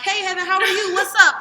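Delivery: high, loud radio hello; acted-out host greeting; on-air greeting. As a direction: welcome a guest onto the mic with big energy.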